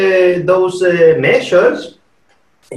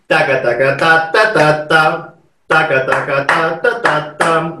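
taka taka ta ta with accents marked; rhythmic ending phrase; 2 semitones lower than usual. Shown as a demonstration. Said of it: "this ending phrase".

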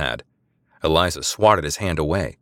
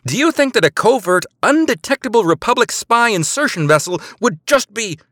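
high, loud outraged tirade; furious spy berating the sergeant; incredulous anger; 13 semitones higher, 6 dB louder than usual. A narrator's reading. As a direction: scold the sergeant in disbelief. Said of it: "incredulous anger".

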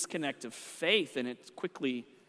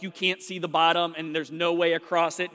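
usual speaking voice

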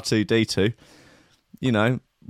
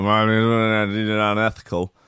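Bannatyne impression; 2 semitones lower, much slower than usual. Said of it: "He'd stick it in and go".

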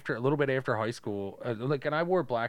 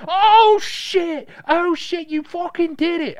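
put-on screaming, very high Baltimore accent; 14 dB louder, slower than usual. Girl cheering the winning rapper, screaming in Baltimore accent. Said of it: "that, like, Baltimore accent".

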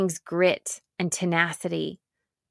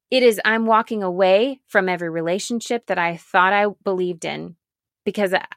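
normal talking voice

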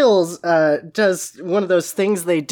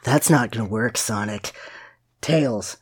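Shadow, brooding; 7 semitones lower, 4 dB quieter than usual. Acted. Co-host reads Shadow's line about crying Tails, low and brooding. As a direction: mutter it low and gruffly dismissive.

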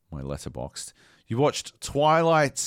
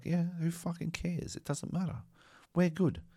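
mock dismissive; 6 semitones higher, 7 dB quieter than usual. Mimics filmmakers shrugging off accent.

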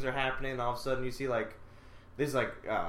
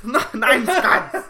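put-on German accent; 6 semitones higher, 9 dB louder, much slower than usual. Mock German, laughing.

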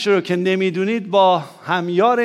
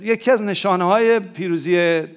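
usual speaking voice

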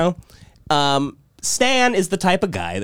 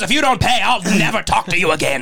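higher, hammed-up ultimatum; mock threat; 5 semitones higher than usual. Godfather threatening godson over the bill, mock outrage. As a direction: imitate an outraged man issuing a threat.

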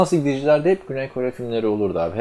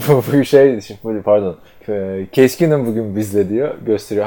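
usual speaking voice

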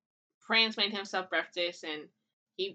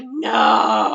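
mock no; 14 semitones lower, 9 dB louder than usual. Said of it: "And I was like".

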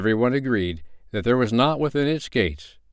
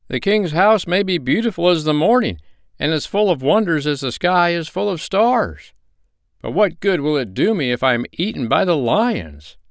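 higher, louder, stubborn; traveler refusing; 9 semitones higher, 4 dB louder than usual. Acted. Frightened traveler refuses his friends, higher and louder. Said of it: "said he".

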